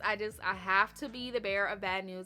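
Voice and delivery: ordinary talk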